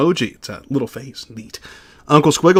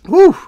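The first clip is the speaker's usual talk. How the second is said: 1 whew; 6 dB louder than usual. Real, not acted. Relief near end of supers.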